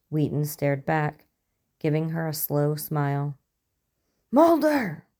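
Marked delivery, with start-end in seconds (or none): 4.3-5.1 high, loud cry for help; desperate shout. A narrator's reading. Scully alerts Mulder as the alien grabs her, shouting desperately. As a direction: shout the name in alarm, pitch leaping up. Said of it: "she shouted".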